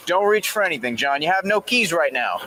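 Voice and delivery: final warning, getting angry; calm turning angry. Real, not acted. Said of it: "officer escalating at resisting driver".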